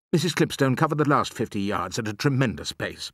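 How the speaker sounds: plain reading voice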